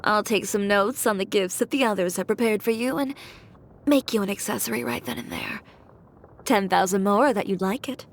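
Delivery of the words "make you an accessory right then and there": usual speaking voice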